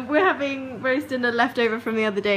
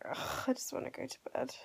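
whispery sigh; 11 dB quieter than usual. Real, not acted. Exhausted unvoiced sigh.

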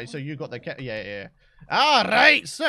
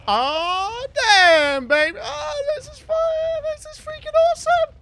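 much higher, louder gushing; thrilled; 8 dB louder than usual. Real, not acted. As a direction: lose it with joy over the Rex. First ride on the Rex.

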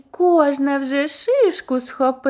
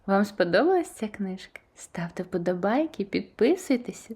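hushed outro whisper; 2 semitones lower, 5 dB quieter, faster than usual. Real, not acted. Storyteller whispers to viewers asking them to like and subscribe.

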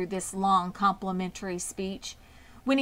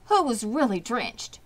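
ordinary delivery

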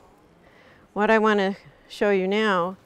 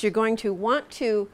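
ordinary talk, nothing marked

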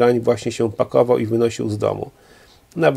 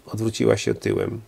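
plain reading voice

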